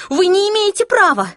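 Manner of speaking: loud, high-pitched protest; shouting. A narrator's reading. Clary confronting the attackers, shouting in protest. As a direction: cry out in outrage, voice way up. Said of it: "закричала Клэри".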